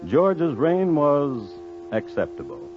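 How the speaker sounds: wry narrator's beat before the punchline; dry pause before acceptable. A narrator's reading. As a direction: hang on was, then drop acceptable.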